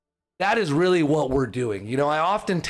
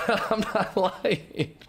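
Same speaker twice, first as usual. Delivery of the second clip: laughing; 8 semitones higher, much slower than usual. Real, not acted. Laughs through the emotion.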